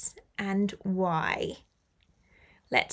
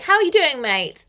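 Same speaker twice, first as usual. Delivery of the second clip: high, loud "how are you doing", dropping on "mate"; punchy, matey British greeting; chummy British greeting; 3 semitones higher, 7 dB louder than usual. Acted. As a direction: toss out a very British hello to a mate. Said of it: "It's very British".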